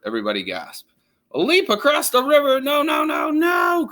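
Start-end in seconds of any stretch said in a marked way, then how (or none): 1.3-3.9 Walrus cries out in alarm; frantic protest; alarmed cry. Acted. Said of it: "cried Walrus".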